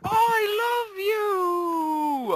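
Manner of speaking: high, very slow drawn-out wail; wailing cry. Pretend. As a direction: stretch it into a howling cry.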